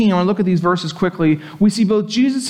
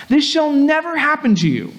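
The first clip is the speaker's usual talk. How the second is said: mildly rebuking, higher pitch; 7 semitones higher than usual. Acted. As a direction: quote an emphatic protest in a raised pitch. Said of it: "began to rebuke him".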